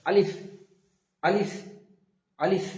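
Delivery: আলিফ with lips slightly apart and a light puff of air; alif demo. Shown as a demonstration. Teacher shows students how to say আলিফ, breathy demo. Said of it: "দুটো ঠোঁট হালকা ফাঁকা থাকবে, একটা বাতাস বেরিয়ে আসবে হালকাভাবে".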